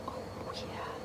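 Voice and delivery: breathless whisper, impressed by the ending; whispered oh yeah. Real, not acted. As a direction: whisper it like a secret thrill. Recalls the thriller's ending.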